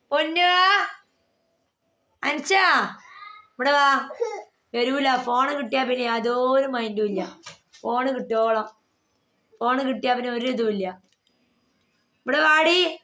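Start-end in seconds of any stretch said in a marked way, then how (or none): 0.1-4.1 calling out. Real, not acted. Mother calling daughter from phone. 12.2-13.0 calling out. Real, not acted. Mother calling daughter again.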